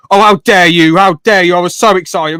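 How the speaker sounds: imitating an offended man; mock outrage. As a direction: ham up his offended protest. Retold indignant reply.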